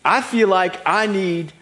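slow, loud, high declaration; grand promise. Acted. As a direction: proclaim a big promise, stretching each phrase. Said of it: "I utter these words".

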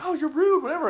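high mock outrage; mock indignant friends. Friends' reaction acted out in story.

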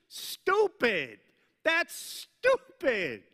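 loud, high-pitched exasperated disbelief; incredulous rant. Acted. Preacher scolding Peter for sending Jesus away, incredulous rant. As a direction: hammer it out, outraged by the foolishness.

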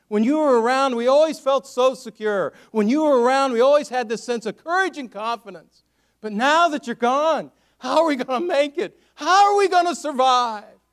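loud, high plea to a lost leader; distraught lament. Acted. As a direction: build from grieving tribute to desperate cry. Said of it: "why he's gonna miss Elijah so much".

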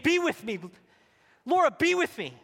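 God calling Laura out onto the storm; calling out loud. Acted. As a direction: call her name across the storm. God calling her by name.

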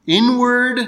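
inward lifted high and loud; stressed inward. Real, not acted. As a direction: lean hard on inward. Key word singled out.

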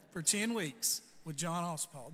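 quoting a friend with a chuckle; amused, slow teasing with a laugh; chuckling friend. Retold or imitated. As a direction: laugh right through the tease. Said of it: "He looked at me and smiled".

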